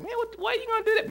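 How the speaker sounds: startled disbelief. Retold or imitated. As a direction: squeak out a startled question. Own startled reaction retold.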